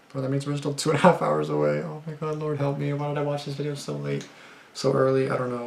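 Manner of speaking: high-pitched, worried whine; spooked and regretting it, pitch held up high; nervous dismay. Real, not acted. Scared, realizing sunrise is hours away.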